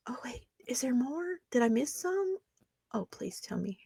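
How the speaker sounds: soft mock-eager whisper; hushed teasing whisper; sarcastic whisper. Sarcastic aside to commenters.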